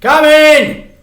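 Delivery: high, loud call toward the front door. Acted. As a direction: shout so the visitor hears through the house. Calling to the aunt at door.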